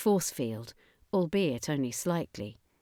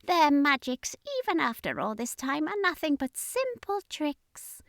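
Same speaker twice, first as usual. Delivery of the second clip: drip contempt on it; high alien sneer; 9 semitones higher than usual. Acted. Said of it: "the commander sneered".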